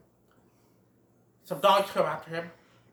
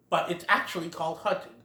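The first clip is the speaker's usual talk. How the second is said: ordinary talk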